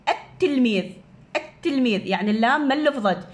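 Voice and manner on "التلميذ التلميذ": التلميذ said at-tilmidh, lam swallowed into a doubled ta; silent lam demo. Shown as a demonstration. Teacher to pupils demonstrating the swallowed lam. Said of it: "من أريد ألفظها".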